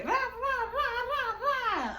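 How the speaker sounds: high rowdy rah chant; mocking the gang lining up at the locker. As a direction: imitate them hyping up. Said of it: "she like".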